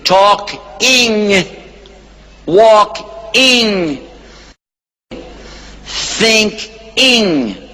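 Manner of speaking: Talking, walking, thinking with the ending drawn out; stress on ING. Shown as a demonstration. Teaching the class the -ing ending, stressing it. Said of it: "you put I N G".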